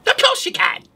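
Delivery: high sly witch voice. Acted. Witch to the wolf, high sly agreement. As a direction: purr it like a scheming witch. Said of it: "the witch said".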